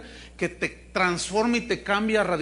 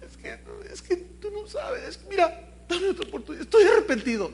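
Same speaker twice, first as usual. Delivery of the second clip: mock crying; 10 semitones higher than usual. Cheating husband fake-cries to his wife. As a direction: fake sobbing, much higher. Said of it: "Y empieza a llorar".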